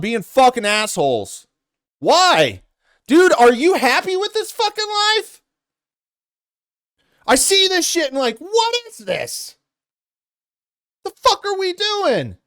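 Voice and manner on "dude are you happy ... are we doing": pitched way up in real exasperation; a real, fed-up cry at how life is going; exasperated outburst. Real, not acted. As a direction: let the frustration push the voice high.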